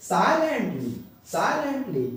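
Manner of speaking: "silently" with pitch arched up on the first syllable, then falling; first-syllable stress. Shown as a demonstration. Teacher reading the rule's example, stress on first syllable. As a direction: stress the SI of "silently", rest lighter. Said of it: "जोर देंगे".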